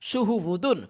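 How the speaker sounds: "সুহু উ দুন" with short, unheld vowels; no stretching. Shown as a demonstration. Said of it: "তাহলে এখানে আমরা টানবো না". ("সুহু উ দুন" টান ছাড়া)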